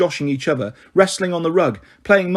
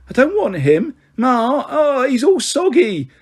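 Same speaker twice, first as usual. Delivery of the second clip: put-on higher, drawn-out boyish complaint, slower at the end; a big brother squirming, disgusted by the soggy baby; whiny protest; 9 semitones higher, slower than usual. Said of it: "Tommy Magpie protested".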